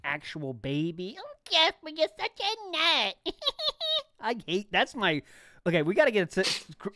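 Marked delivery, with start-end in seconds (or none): 1.2-3.1 put-on high, cooing baby-talk voice, much higher than his own; mock baby talk. Podcaster mocks the streamer cooing at his dog Jasper, high mock baby talk. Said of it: "mocking his baby talk to his dog". 3.2-4.0 high laugh. Real, not acted. Laughs after his own impression.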